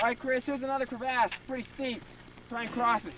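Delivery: loud directions across the ice; calling out. Real, not acted. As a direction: call it out to the climber.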